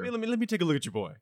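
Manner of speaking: fast, high fussy doctor; quick fussy doctor; doctor character voice. Acted. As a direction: rattle it off like a busy medic. GM voicing doctor NPC.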